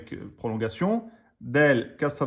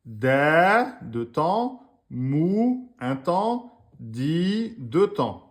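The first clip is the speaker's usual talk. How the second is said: sounding out a word slowly for students; syllable by syllable; 3 semitones higher, much slower than usual. Shown as a demonstration. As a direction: call out each syllable for the class. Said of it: "on a bien a".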